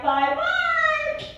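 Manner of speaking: mock naive child; childish bye. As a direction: chirp it back like a kid. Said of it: "I'm like".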